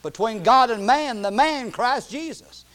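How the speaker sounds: high, loud pulpit preaching; impassioned preaching. Real, not acted. Preacher warning the church that only Jesus mediates, impassioned preaching. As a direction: drive it home, drawing out the key words.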